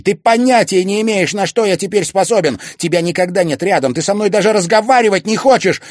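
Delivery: high, very loud reproach; angry shout. A narrator's reading. Son reproaching his absent mother, shouting. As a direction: keep yelling the grievances.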